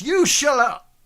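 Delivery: high, loud angry snap; shouting back. Acted. Thug yelling at Leonard to shut up. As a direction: cut him off hard.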